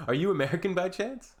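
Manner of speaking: lightly needling question; sarcastic question. Real, not acted. Replying to an ignorant commenter, mock sarcasm. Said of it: "sarcastic comeback to commenter".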